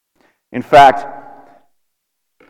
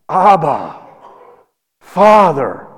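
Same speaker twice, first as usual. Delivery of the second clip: distressed prayer; much slower than usual. Acted. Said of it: "I can't even imagine the distress".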